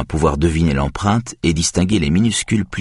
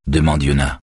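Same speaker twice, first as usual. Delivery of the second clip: ordinary delivery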